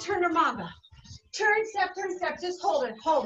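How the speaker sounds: upbeat workout cues over music; aerobics instructor. Real, not acted. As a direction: call the moves to the class. Calling aerobics moves over music.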